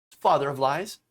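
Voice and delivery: normal talking voice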